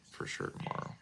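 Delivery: whispered. Shown as a demonstration. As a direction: whisper it back to yourself. Repeats phrase, second time whispered.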